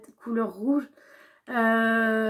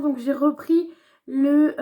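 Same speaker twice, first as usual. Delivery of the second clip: normal talking voice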